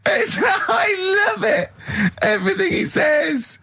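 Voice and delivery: high, slow words squeezed through laughter; real helpless laughter; laughing hysterically. Real, not acted. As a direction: barely get it out for laughing. Ricky laughing uncontrollably.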